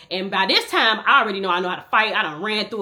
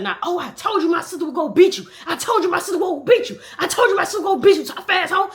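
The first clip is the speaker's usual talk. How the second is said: gloating brother; 9 semitones higher, 4 dB louder, faster than usual. Retold or imitated. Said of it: "My brother said".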